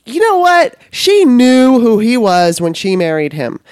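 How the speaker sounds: loud, emphatic rant; heated. Real, not acted. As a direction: push it with indignation. Heated rant about Melania.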